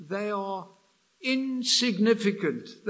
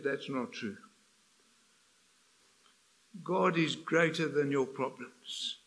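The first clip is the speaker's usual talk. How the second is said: quiet correction; 6 semitones lower, 6 dB quieter than usual. Retold or imitated. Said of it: "He said".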